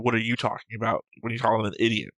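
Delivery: usual speaking voice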